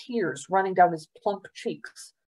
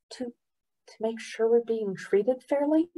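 higher, tentative questioning answer; hesitant boy; 3 semitones higher, 4 dB quieter than usual. A narrator's reading. Boy guesses why governor came, hesitant. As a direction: venture a guess, unsure.

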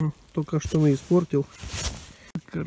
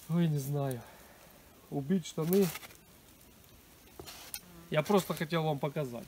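normal talking voice

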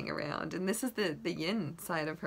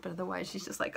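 normal talking voice

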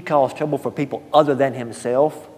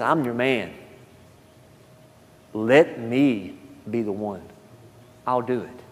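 humble offer; 2 semitones lower than usual. Acted. Isaiah answering God humbly.

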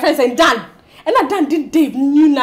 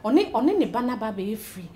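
low, soft confiding; subdued worry; 4 semitones lower, 10 dB quieter than usual. Acted. Woman confiding suspicions about Dave to her friend, subdued. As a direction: let the voice sink as suspicion sets in.

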